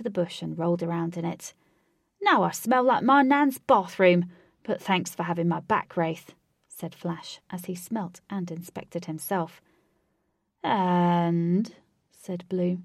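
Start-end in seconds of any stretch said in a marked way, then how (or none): 2.2-6.3 put-on disgusted young man voice. Flash disgusted after rolling in bush. 10.6-11.7 talking cat voice. Acted. Cat Blue speaks.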